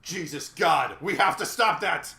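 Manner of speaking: mock alarm. Real, not acted. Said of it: "sarcastic mock alarm".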